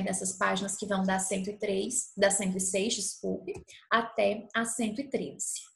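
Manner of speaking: ordinary talk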